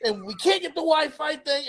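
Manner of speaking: high-pitched flustered mom imitation; panicked mom. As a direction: mom rushing her son off the phone. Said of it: "she's like".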